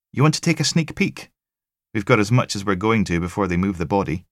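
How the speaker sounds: dry sympathy from the tech; wry female tech. Acted. Tech telling Logan about the sick pathologist, wry.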